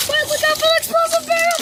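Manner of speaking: fast, high urgent warning. Real, not acted. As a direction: yell the warning quickly. Frantic driving in a game.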